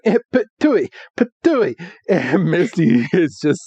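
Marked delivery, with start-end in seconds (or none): 0.0-1.7 higher mock spit sound; cartoon spit; spitting out water. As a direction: spit the water out with a comic patooey. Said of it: "And he's like".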